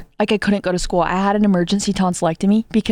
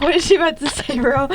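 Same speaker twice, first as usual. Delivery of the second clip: higher, giggling retold thought; laughing quote; 6 semitones higher than usual. Recalling the car affirmation with her friend, laughing. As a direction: laugh through your own puzzled question. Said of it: "I was like".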